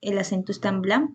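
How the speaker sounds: ordinary talk, nothing marked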